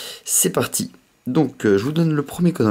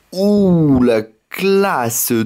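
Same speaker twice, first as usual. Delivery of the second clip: impressed; 6 semitones higher, 4 dB louder, much slower than usual. Real, not acted. Impressed by a rare card.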